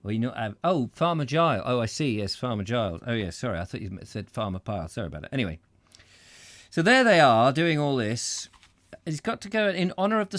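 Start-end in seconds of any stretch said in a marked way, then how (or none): none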